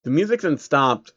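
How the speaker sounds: ordinary delivery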